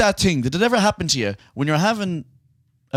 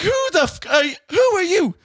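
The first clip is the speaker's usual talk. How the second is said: much higher, mock indignant yell; mock outraged yell; 10 semitones higher than usual. Host retelling how he yelled at a stranger in his bed, mock outraged. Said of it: "I was like".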